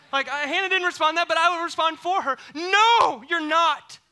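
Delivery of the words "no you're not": loud, high, blunt retort; blunt shout. Acted. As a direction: fire back for her with gusto. Preacher answering for Hannah.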